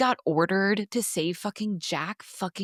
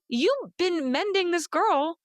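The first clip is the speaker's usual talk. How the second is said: indignant rant; 9 semitones higher, slower than usual. Retold or imitated. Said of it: "meanwhile, I'm like".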